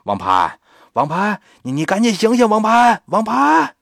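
一声高过一声地喊名字; 越叫越高，最后一声拔尖; 连声呼喊. Acted. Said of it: "还跟那儿喊呢". (李婶不顾门外，一个劲地喊孩子)

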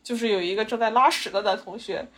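usual speaking voice

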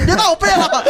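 laughing comic plea; laughing mock plea. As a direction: squeal the line between laughs.

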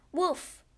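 high playful woof; dog bark. Acted. Ship's dog greets Crusoe.

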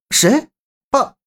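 sharp high startled cry; surprised. A narrator's reading. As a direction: blurt it out in shock. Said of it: "周震惊讶地喝道".

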